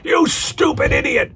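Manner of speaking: over-the-top outraged yell, very high; McMahon. Retold or imitated. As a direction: imitate a boss's hammy meltdown. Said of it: "McMahon is so over the top".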